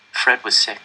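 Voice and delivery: ordinary delivery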